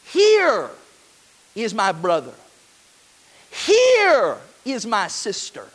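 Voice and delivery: slow, weighty, each phrase set apart by pauses; emphatic scripture quote. Acted. Preacher proclaiming Jesus' words to the congregation, slow and weighty. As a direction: land every Here like a proclamation.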